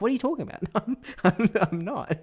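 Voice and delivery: high, loud, broad Aussie protest; mock a drunk uncle snapping back into Australian; indignant Australian accent. Imitating uncle caught out by aunt, indignant high Australian accent. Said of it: "snaps straight back into the Australian accent".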